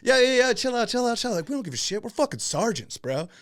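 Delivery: high, loud, brash sergeant voice; loud mock bravado; high loud bluster. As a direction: yell it like a cocky sergeant. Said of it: "And I was like".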